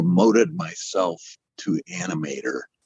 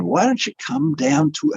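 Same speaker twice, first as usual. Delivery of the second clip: put-on a first go at an Australian accent, pitched high; friend's Australian accent, voiced high. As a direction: try out an Aussie accent on the friend's invitation. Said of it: "I'm trying to do an Australian accent".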